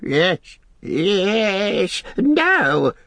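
high creature shriek, climbing; Gollum shrieking. Acted. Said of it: "shrieked Gollum".

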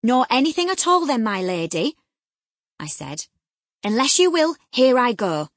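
loud, high firm threat; stern threat. A narrator's reading. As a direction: threaten firmly. Nurse threatening to show father.

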